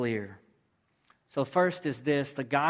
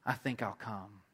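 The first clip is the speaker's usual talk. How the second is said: low, hushed reply on the edge of tears; tearful acceptance; 4 semitones lower, 7 dB quieter than usual. Retold or imitated. Atheist guest moved by the couple's love, quietly tearful. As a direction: accept quietly, as if retelling someone choked up. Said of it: "tears welling up in his eyes".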